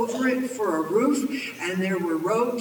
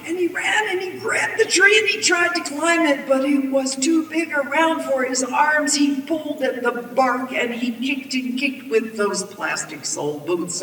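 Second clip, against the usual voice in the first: frantic narration; 6 semitones higher, 4 dB louder than usual. A narrator's reading. Storyteller telling of the villain's scramble up, frantic. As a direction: rush into the action at a high pitch.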